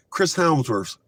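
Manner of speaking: usual speaking voice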